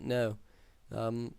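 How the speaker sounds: usual speaking voice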